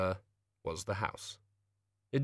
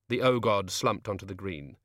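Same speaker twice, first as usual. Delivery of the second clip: plain reading voice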